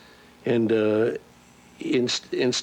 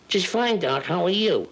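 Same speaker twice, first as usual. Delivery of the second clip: much higher, breezy cartoon rabbit voice; Bugs Bunny; 9 semitones higher than usual. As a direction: answer the doctor cheerfully as the rabbit. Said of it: "how you feeling, Bugs Bunny?".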